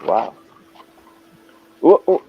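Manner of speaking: ordinary talk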